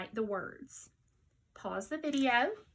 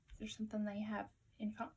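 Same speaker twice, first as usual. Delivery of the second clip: whisper; 7 dB quieter, much faster than usual. Real, not acted.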